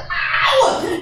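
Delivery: high squeal of mock pain, laughing; laughing high yelp. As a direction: yelp it like a kid caught out. Kids' mock pained reply.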